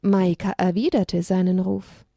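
usual speaking voice

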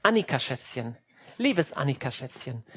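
low, soft coaxing; lenient, sweet parent. Acted. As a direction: coax the child gently with pet names. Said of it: "ich bin nicht so streng". (sanft und lieb, gespielt)

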